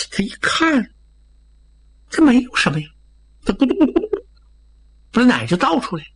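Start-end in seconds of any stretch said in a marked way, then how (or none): 3.5-4.3 quick, high mimicked glugging; gurgling pour imitation. A narrator's reading. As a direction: imitate liquid glugging out of a bottle. Narrator imitates milk being poured. (模仿倒奶的咕嘟声)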